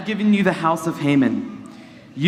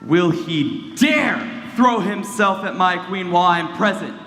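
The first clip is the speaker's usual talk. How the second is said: usual speaking voice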